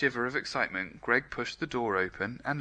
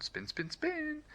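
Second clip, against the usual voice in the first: very high, soft, slow spin spin; spinning; 7 dB quieter, slower than usual. A narrator's reading. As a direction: float the spins up high.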